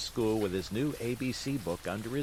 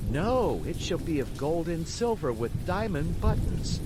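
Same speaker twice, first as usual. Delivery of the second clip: rising, louder excitement; excited; 7 semitones higher, 5 dB louder than usual. A narrator's reading. Puppet dreams aloud of gifts for his father, excited. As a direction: get carried away dreaming big.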